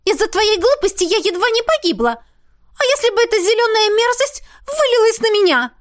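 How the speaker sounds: high, loud, tearful scolding; shrill spoiled princess tirade; whiny princess. A narrator's reading. Princess scolding her favourite.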